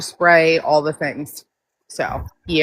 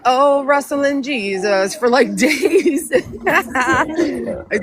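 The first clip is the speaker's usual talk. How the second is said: laughing; 6 semitones higher, much slower than usual. Retold or imitated. Laughing while recounting rough week.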